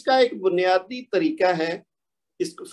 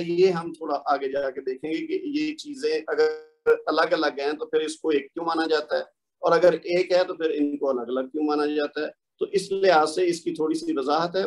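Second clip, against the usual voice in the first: usual speaking voice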